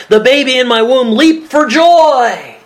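high, drawn-out jubilant cry; joyful exclamation. Acted. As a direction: exclaim it high, stretching the joy. Preacher acts Elizabeth's joyful words.

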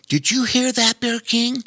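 high curious otter; listening little otter; squeaky otter voice. A narrator's reading. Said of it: "he asked".

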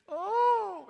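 high drawn-out wail of distress; loud anguished cry. Retold or imitated. A man on the floor crying out in anguish.

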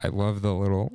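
ordinary talk, nothing marked

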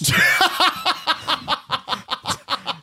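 bursting out in high laughter. Real, not acted. Laughing at his own joke.

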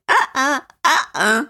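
mock high-pitched baby whine; whining baby voice. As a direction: imitate a singer's whiny babyish fade-out. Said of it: "she does that whining baby voice".

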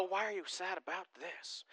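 a quiet inner question; inner puzzlement. Retold or imitated. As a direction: mutter it inside your head. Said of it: "look at and go".

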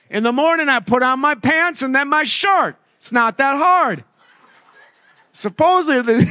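high mock dad voice, baffled; clueless dad voice. Preacher mocks dads who don't know baby dressing, in a high mock dad voice.

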